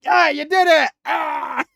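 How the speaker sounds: high, loud, put-on cheering voice ending in a shout; excited cheer. Rain cheering Melia after her kick lands, hammed-up joy. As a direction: celebrate it at the top of your voice. Celebrating the knockdown.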